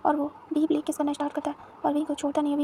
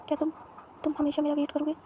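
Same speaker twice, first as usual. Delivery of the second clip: ordinary delivery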